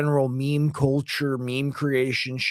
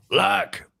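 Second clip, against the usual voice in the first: mock impersonator's like; 4 semitones higher, much slower than usual. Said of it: "My dad doesn't talk like that".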